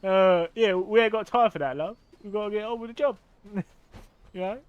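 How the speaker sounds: Cockney geezer. Acted. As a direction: brush them off like a busy Cockney. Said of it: "The Cockney geezer crows".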